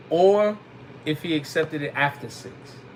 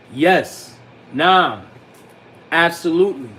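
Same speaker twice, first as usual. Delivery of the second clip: firm affirmation; 6 dB louder, slower than usual. Shown as a demonstration. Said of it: "answer in the affirmative".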